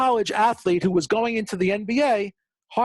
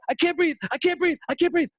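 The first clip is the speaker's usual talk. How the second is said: drowning panic; 7 semitones higher than usual. Retold or imitated. Drowning man held under again.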